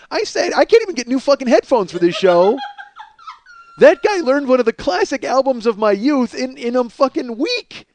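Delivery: high, loud, exasperated rant; building outrage, pitch climbing high and loud; incredulous rant. Real, not acted. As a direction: rant in disbelief at the effort. Ranting about co-host learning album.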